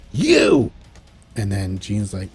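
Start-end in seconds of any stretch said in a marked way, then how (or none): 0.1-0.7 high, loud accusing cry; mock villain shout; hostile shout. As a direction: spit the word at her. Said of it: "Bad Jean's like".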